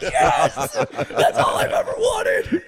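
high, airless laughing gush; laughing squeal. Retold or imitated. Starstruck comic gushing at Lovitz, laughing. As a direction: squeal the words through laughter. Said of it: "I was like".

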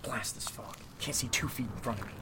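whispered grumble to herself. Acted. Alone in the fog, whispering complaints.

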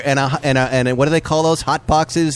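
ordinary talk

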